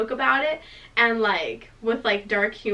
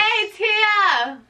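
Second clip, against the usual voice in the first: high, drawn-out mock yell; 10 semitones higher, 4 dB louder than usual. Abe hailing a friend above, high retold yell. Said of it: "yelling up at me".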